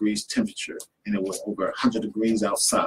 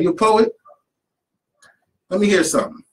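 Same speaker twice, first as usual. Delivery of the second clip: high, loud mock challenge; lightly voiced man daring him to perform; gruff challenge; 10 semitones higher, 6 dB louder than usual. Grandpa's friend demands a poem.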